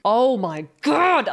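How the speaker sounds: drawn-out amazed exclamation; really excited. Real, not acted. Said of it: "Sorry, I'm really excited".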